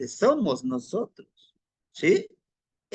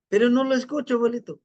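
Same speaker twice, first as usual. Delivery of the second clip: put-on frustrated young man; 6 semitones higher, 4 dB louder, faster than usual. Boy frustrated with grandfather.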